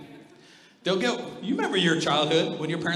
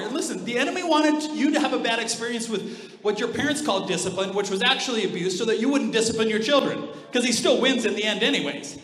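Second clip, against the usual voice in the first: ordinary delivery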